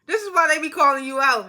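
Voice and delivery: laughing disbelief at Sonya; scolding the TV, loud and amused; exasperated come-on. Retold or imitated. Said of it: "I was like".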